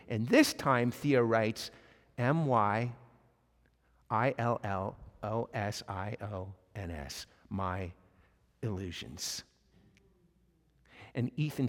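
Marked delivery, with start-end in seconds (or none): 2.2-9.4 low, hushed, with long pauses; halting spelling out. Retold or imitated. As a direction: spell it quietly as if writing, then read it back. Voices Theo writing final answer.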